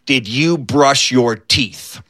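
slow, low, gravely serious question; mock serious. Skeeter questions his bunkmate about toothpaste, mock serious. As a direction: ask it with deadly seriousness, word by word. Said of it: "as seriously as he could".

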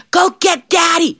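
high, urgent youngster's voice; scared little devil. Acted. Little devil sends for father devil, scared. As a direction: call for help like a frightened kid.

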